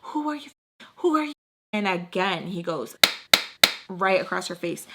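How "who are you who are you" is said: high, pushy mock teen voice, much higher; imitating the daughter. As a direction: throw it back at the father in a high voice. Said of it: "She's like".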